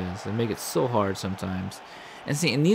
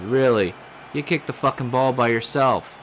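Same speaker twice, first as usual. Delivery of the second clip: loud, higher disbelieving rage; furious outburst; 4 semitones higher, 6 dB louder than usual. Real, not acted. Gamer yelling at his player for kicking the ball away, furious. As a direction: explode at the clumsy player.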